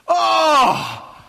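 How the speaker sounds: loud, high mock cry of frustration; big hammed-up "oh" of complaint; exasperated groan. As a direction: groan out loud like a fed-up crowd. Murmuring crowd acted out.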